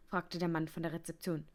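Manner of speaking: ordinary delivery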